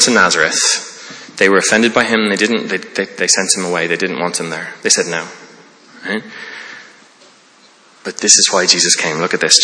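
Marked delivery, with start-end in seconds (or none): none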